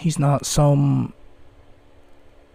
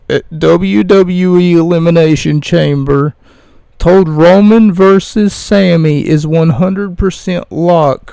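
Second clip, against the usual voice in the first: breaking news read; 5 dB louder than usual. Acted. Reads wrestling news.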